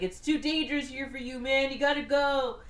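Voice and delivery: mock urgent warning. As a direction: insist with hammed-up urgency. Friend's retold urgent warning.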